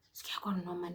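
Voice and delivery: hushed unvoiced whisper. Whispering to himself, afraid of being caught. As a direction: whisper it so nobody hears.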